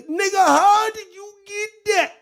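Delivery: very high, insistent wail; high put-on voice of disbelief; Cornelius. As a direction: push it up into a high cartoonish cry. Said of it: "And Mike Cornelius".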